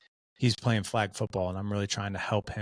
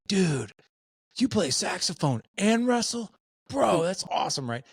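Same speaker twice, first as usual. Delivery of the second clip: high, excited surfer-bro praise, noticeably higher; 1 hyped bro voice; 10 semitones higher, 3 dB louder than usual. Retold or imitated. Impressed wrestlers hyping up the sax-playing kid, excited bro voice. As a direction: gush like an impressed teammate.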